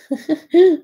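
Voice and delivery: a short, bright burst of real laughter; amused laugh. Real, not acted. Host laughs at a viewer's comment, amused laugh.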